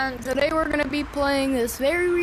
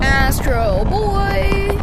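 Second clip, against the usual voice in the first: excited slow call; 6 semitones higher, 6 dB louder, much slower than usual. Real, not acted. Flying like a hero.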